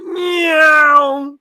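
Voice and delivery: long, high, loud whine like a passing bike; high sustained "neeeow"; drawn-out engine meow. Retold or imitated. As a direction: imitate a motorbike screaming past. Imitates sound of motorbikes passing.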